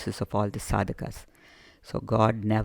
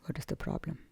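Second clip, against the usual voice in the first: very soft, higher-pitched prompt; hushed question; 6 semitones higher, 14 dB quieter than usual. Real, not acted. Teacher prodding silent listeners about name and form, hushed. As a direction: ask it gently, barely voiced.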